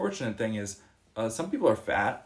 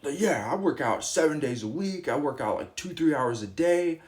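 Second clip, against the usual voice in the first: boastful, louder and higher, self-important; bragging reply; 4 semitones higher, 4 dB louder than usual. Retold or imitated. Old self answering a fitness question, proudly bragging. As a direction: show off about the workout routine. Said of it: "I used to say".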